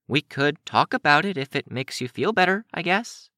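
put-on high, hesitant girl voice; high-voiced, unsure suggestion; tentative Finale. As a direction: offer it shyly, pitched high.

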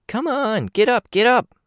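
very high-pitched, excited egging on; high, piping urging; goading. A narrator's reading. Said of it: "Kenzie goaded him".

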